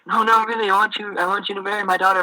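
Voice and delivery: don't take no for an answer; insistent dad. Retold or imitated.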